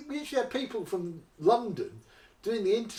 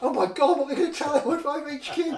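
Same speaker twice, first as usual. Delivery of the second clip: mock panic; 8 semitones higher, 5 dB louder than usual. Duped officer panics over lost money.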